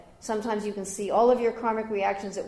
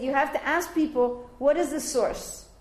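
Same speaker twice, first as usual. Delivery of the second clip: raised, louder insistent question; demanding the source, louder; emphatic retold question; 7 semitones higher, 5 dB louder, slower than usual. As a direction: press them for the source. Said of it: "And I said".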